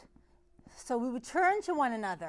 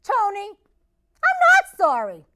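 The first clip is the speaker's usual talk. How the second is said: loud, high, defiant outburst; teen yelling back at the driver; aggravated yell; 13 dB louder than usual. Retold or imitated. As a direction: fire it back at him. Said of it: "I finally kinda got a little aggravated".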